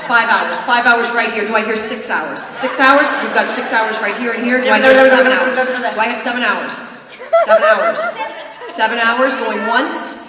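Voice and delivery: loud mock auctioneer calling bids; auctioneer patter. Mock auction for driving hours.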